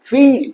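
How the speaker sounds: "ফিল" with a high, stretched ee; long vowel. Shown as a demonstration. Teacher demonstrating long vowel sounds, long drawn-out "ফিল". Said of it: "টেনে উচ্চারণ হচ্ছে".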